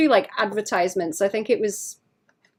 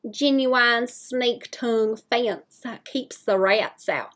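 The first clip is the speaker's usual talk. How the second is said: put-on southern accent; 3 semitones higher, slower than usual. Streamer doing a southern accent for chat. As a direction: drawl it out like Cherry, southern style. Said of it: "southern redneck American accent".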